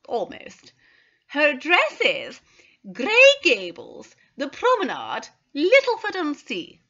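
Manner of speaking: high, loud, eager recall; suddenly hopeful, proudly naming her. Acted. Mrs Carter telling the assistant her cousin's address, eager. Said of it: "her face cleared".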